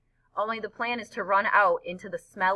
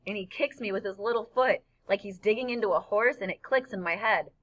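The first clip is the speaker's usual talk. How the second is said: usual speaking voice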